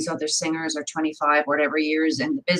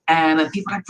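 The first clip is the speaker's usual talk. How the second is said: ordinary delivery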